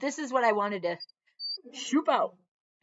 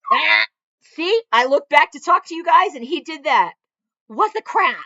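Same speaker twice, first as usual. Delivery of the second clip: loud, high startled exclaim; exasperated outburst; 8 semitones higher, 13 dB louder than usual. Real, not acted. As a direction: call out over the barking dog. Dog interrupts with loud barking.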